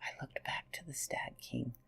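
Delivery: hushed, breathy campfire whisper; whispered storytelling. A narrator's reading. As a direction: tell it like a secret in the dark. Whispered narration.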